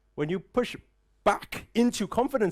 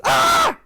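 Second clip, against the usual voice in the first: shrill, very high, loud startled scream; a mother's loud panicked shriek; mum's shriek; 11 dB louder than usual. Retold or imitated. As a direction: shriek in sudden alarm. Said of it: "Mum walks in and goes".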